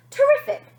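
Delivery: thrilled by the phobia; delighted. Acted. Producer delighted.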